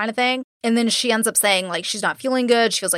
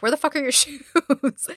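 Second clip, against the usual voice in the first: higher-pitched quote breaking into laughter; laughing mock disbelief; 5 semitones higher than usual. Imitating Darren's shoe question to a co-host, laughing. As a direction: crack up while imitating the question.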